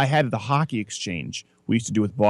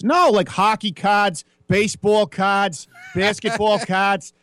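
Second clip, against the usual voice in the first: slow, higher mock Boston accent listing cards; 7 semitones higher, slower than usual. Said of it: "they were severe Boston accents".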